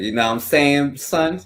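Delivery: high, loud mock jab at a friend; mock teasing. Host teasing his cohost, mock jab. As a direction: tease him in a put-on tone. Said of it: "No, I'm joking".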